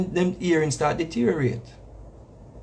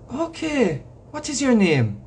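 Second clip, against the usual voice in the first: put-on higher, polite old-lady voice; lightly mimicked old lady, pitched up; elderly lady; 6 semitones higher, slower than usual. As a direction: imitate an elderly woman making polite enquiries. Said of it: "elderly lady".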